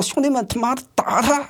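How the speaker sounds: high-pitched call to the gang; rally your mates to attack, acted out; urgent, strained high-pitched imitation; acted rallying cry.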